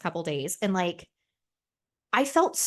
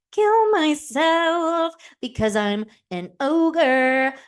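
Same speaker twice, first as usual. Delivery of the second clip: hammed-up despair, higher, louder and slow; mock melodramatic wail; 8 semitones higher, 8 dB louder, much slower than usual. Herself during PMS, moaning self-pity, hammed-up slow loud wail. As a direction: wail it like a tragic ogre.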